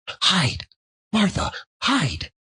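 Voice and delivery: put-on high, urgent child's hiss-free urging; urgent children. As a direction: push her to hide like eager kids. Children urging Martha to hide.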